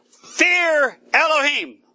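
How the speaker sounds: slow, high, loud proclaiming; booming heavenly proclamation; angel's loud cry. Acted. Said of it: "with a loud voice".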